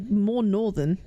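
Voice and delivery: ordinary talk, nothing marked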